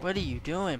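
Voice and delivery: ordinary delivery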